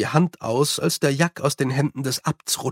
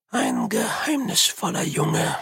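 thoughtful. A narrator's reading. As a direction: ponder the boy aloud. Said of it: "sagte er nachdenklich". (nachdenklich und langsam)

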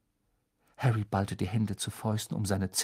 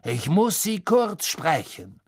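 louder, higher, slow demand; curt summons; 7 semitones higher, 5 dB louder than usual. Acted. Snape summoning Lupin through the fire. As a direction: summon him curtly.

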